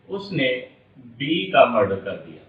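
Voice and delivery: ordinary talk, nothing marked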